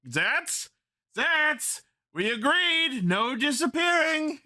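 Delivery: high, pleading monster cry; a baffled villain calling out. Acted. Monster calls for vanished hero.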